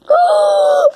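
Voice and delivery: loud, high gasp of delight. Acted. Character spotting a squeaky chicken, delighted gasp. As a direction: gasp big at the surprise.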